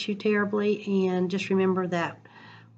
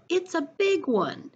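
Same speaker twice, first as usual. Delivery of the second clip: excited Papa; 11 semitones higher, slower than usual. Acted. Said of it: "called Papa".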